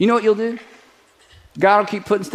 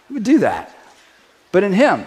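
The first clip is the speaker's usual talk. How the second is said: normal talking voice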